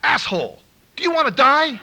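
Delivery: loud, high threatening yell; menacing yell. Retold or imitated. Sponsor yelling at him on the phone, loud menace.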